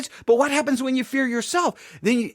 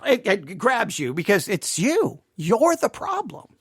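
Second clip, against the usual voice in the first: ordinary delivery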